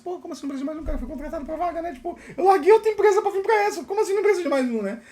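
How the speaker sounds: high-pitched, indignant disbelief; incredulous protest. Retold or imitated. New hire reacting to being unwanted, incredulous high protest.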